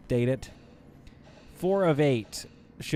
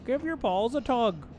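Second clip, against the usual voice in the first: put-on high-pitched Letterkenny-style prairie accent; prairie Canadian accent; 12 semitones higher than usual. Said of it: "Like, it's kinda high pitch".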